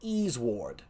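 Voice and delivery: soft, low reversed name; murmured backwards name; backwards speech. Shown as a demonstration. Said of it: "I'm gonna say it twice".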